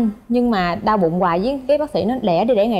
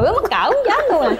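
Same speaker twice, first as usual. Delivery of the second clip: embarrassed squeal; 10 semitones higher than usual. Retold or imitated. Retold own embarrassed words.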